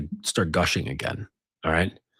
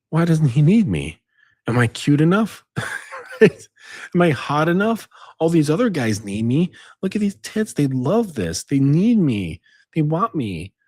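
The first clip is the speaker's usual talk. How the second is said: high, girlish mock voice of an insecure woman; 7 semitones higher than usual. Man mocking a woman wondering why he doesn't need her, high girlish voice. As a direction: ham up a woman fishing for compliments. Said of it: "And she's like".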